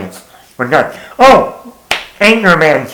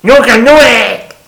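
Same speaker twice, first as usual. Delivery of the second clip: Rob Schneider quote; 6 semitones higher, 5 dB louder than usual. Retold or imitated. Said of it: "and he says".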